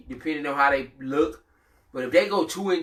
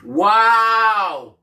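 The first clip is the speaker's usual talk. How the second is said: surprised; 7 semitones higher, 8 dB louder than usual. Real, not acted. Mock shock at washed player.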